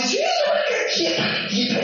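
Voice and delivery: very high imitation of a passive woman; mock helplessness. Preacher replays the chica's answer, mock helplessness. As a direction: squeak out the feeble excuse in mimicry.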